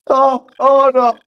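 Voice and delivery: high, laughing through words; can't stop laughing. Real, not acted. Laughing at the glitched voice.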